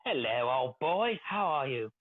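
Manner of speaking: slow, hammed-up British old-boy greeting; mock British gent. Host greeting a co-host as old boy, mock British gent. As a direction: draw out a put-on British gentleman's hello.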